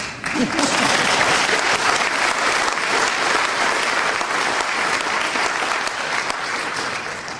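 long breathy unvoiced laugh; breathy chuckle after a punchline; airy laugh. Real, not acted. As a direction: laugh silently at your own joke. Laughing at his own remark.

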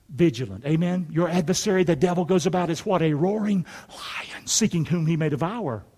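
normal talking voice

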